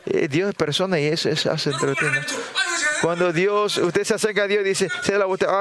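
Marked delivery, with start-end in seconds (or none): none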